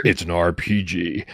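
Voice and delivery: slow dramatic movie-trailer read. Shown as a demonstration. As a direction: drag it out, deep and dramatic. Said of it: "I really went deeper than I think maybe she would".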